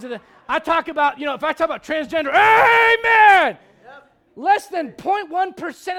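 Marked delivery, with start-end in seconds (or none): 2.3-3.6 high, loud shouted amen to rouse the crowd. Real, not acted. Preacher to congregation after a hard point, loud shouted amen. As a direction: belt it out, demanding a response.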